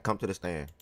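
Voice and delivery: ordinary talk, nothing marked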